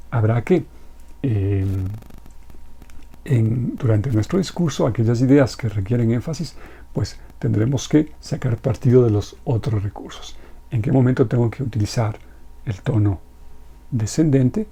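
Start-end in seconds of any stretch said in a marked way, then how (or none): none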